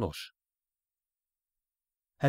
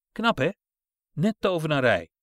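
mocking glee; 3 semitones higher, 5 dB louder than usual. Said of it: "zei ze".

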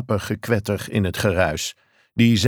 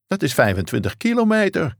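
a read shout of disbelief; lightly voiced shout down the phone; mild shout; 9 semitones higher than usual. A narrator's reading. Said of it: "schreeuwde Thorson".

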